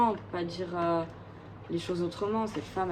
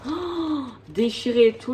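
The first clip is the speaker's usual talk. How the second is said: dismayed surprise; 6 semitones higher, 5 dB louder than usual. Real, not acted. Finds the torn vinyl sleeve.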